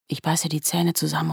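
ordinary delivery

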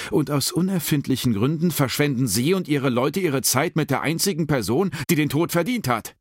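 high-pitched angry tirade; furious. A narrator's reading. Baxter losing her temper at the investigators, furious retort. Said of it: "dann wurde auch sie wütend".

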